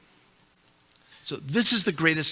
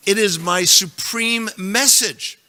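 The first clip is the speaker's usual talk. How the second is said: slow, weighty declaration with a fall on message; emphatic; 4 semitones higher, 4 dB louder, slower than usual. Shown as a demonstration. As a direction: proclaim it slowly, every word weighted. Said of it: "Do you think he's being emphatic here?".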